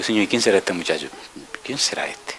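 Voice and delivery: a hushed prayer, the last words barely breathed; whispered prayer. Retold or imitated. Preacher retelling his silent prayer on seeing the young man, whispered. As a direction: mutter a private question to God, dropping almost to nothing.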